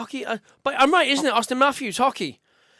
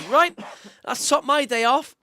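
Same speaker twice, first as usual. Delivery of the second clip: imitating a mate's deadpan line, said higher and louder; a hint of his dry mate, slow and raised; dry deadpan quip; 5 semitones higher, 4 dB louder, slower than usual. Mate hit by a squash ball, mock deadpan and raised. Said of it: "and he goes".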